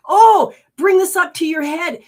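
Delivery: voicing the body, high and loud; body's voice. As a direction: give the body an eager, high voice. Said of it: "Your body says".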